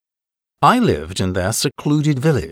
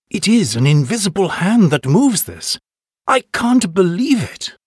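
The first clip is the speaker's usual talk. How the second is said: higher-pitched exclamation of disbelief; amazed exclamation; 7 semitones higher than usual. A narrator's reading. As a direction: exclaim it in wonder, voice climbing higher. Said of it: "exclaimed the chief".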